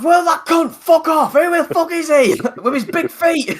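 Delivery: high, shouted northern English rant; northern English accent. Retold or imitated. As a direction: imitate a friend's loud, outraged northern English outburst. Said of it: "He sounds so northern".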